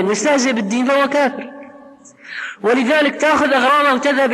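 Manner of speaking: rising preacher's voice, pressing the point; forceful preaching. Acted. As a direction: drive the ruling home to the brothers.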